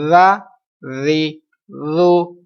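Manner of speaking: ر، ری، رو each begun with a soft th as in "this"; slow, drawn-out ر، ری، رو, lisped with no whistle; soft dhal drill. Shown as a demonstration. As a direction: model ر، ری، رو tongue tip to teeth. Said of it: "زال پر آپ نے سیٹی کی آواز سختی نہیں نکالنی".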